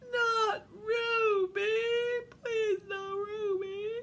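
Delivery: high, drawn-out wail of protest; pleading reindeer. Acted. Reindeer plead against Ruby being picked, high pleading wail. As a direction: beg in a high whiny wail. Reindeer begging in picture book.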